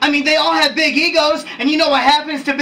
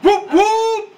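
high-pitched whooping holler; hype call; 7 semitones higher than usual. Acted. Hype call to the scolding woman.

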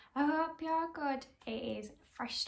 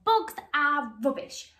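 louder scornful pea voice; evil pea sneer; 8 dB louder than usual. Acted. The evil pea sneering at the reading veggies, loud. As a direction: spit out "Books are rubbish" as a loud sneer.

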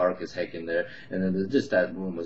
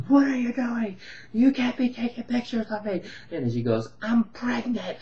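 shrill, much higher furious shriek; pissed off yell; 14 semitones higher than usual. Retold or imitated. Woman rushing the photographer in the dark room, shrill furious shriek. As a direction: charge at him like a pissed off rhino. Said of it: "rushing towards me like a pissed off rhino".

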